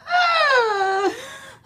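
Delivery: high, drawn-out whimpering sigh; comic crying moan; mock tearful wail. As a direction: let out an exaggerated sobbing sigh. Acting out laughing while crying.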